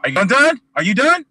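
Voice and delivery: high, sharp challenge; cutting him off, fed up. Real, not acted. Snapping at the shouting co-host, high and fed up.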